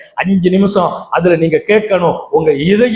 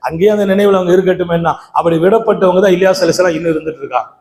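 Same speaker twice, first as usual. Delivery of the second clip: ordinary talk, nothing marked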